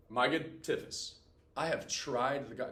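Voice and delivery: slowed, savoring each word; lingering slow reread of Jason's line. Shown as a demonstration. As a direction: read it again slowly, dwelling on it. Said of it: "Wild sentence".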